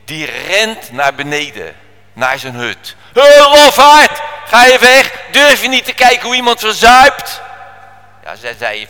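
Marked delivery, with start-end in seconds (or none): 3.1-7.4 loud, high, scornful yell; angry jeering shout. Acted. Sailor berating the boy who runs below, loud scornful shout. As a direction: bellow after the coward.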